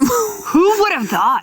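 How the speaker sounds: high, slow mock amazement; mock wonder at an obvious headline; sarcastic. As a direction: drip sarcasm, drawn out.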